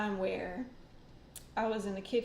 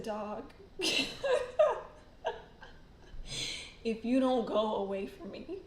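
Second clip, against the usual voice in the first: laughing warning. Retold or imitated. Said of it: "I said".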